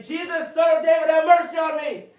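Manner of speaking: loud, high desperate plea; yelling out. Acted. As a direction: yell it over the crowd. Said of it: "he's yelling out".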